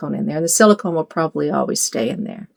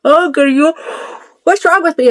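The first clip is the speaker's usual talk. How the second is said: ordinary delivery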